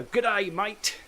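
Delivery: a hammed-up Australian "G'day, mate" with a rising finish; mock Australian accent. Recalling Jim Carrey's movie greeting, in a hammed-up Australian accent. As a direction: imitate a Hollywood actor's over-the-top Australian greeting. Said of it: "Jim Carrey poking his head out the window".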